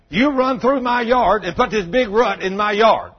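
loud, slow, angry accusing; angry accusation. Retold or imitated. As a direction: bark it at him like an angry neighbour. Angry man accuses preacher.

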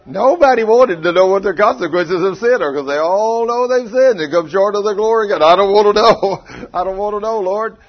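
preacher booming at the church; preaching at full force. Acted. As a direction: belt it to the congregation then mock the sinner. Preaching peak, mock sinner.

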